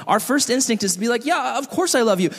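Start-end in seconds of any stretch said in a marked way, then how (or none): 1.2-2.3 high-pitched, instant protest; mock defensive reply, pitched up. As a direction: jump straight in to defend yourself. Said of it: "go straight to a defense".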